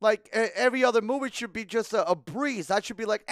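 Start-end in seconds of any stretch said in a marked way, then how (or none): none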